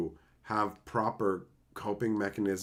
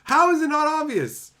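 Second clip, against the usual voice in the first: mock whiny regret; 9 semitones higher, 8 dB louder than usual. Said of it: "Triforce joke, voicing the guy".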